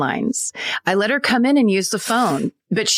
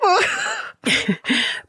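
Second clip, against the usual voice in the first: high giggle. Real, not acted. Laughs at own silly call.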